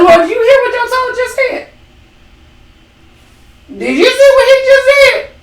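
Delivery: put-on high whiny tattling child telling on him; tattletale child. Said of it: "I started being like a fifth child would be, a tattletale".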